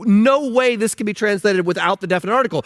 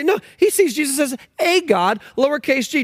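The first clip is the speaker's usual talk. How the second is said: mocking quote; 7 semitones higher than usual. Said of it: "And they say".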